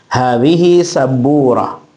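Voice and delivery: slow falling statement; "hadhihi sabura" as plain information, drawn out; informative intonation. Shown as a demonstration. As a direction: state "hadhihi sabura" slowly, ending on a fall. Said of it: "Intonasinya hanyalah informasi".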